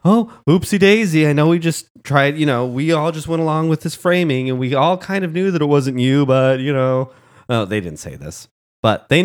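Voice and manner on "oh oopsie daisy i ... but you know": higher, sing-song mock apology, sneering; hammed-up fake-innocent oopsie; mocking Jedi voice. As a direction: brush off the framing like a smug council member. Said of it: "these arrogant prick Jedis are like".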